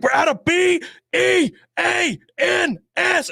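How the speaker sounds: high, slow shout spelling each letter; mock spelled yell. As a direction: spell it out like a furious worker. Worker spelling angrily.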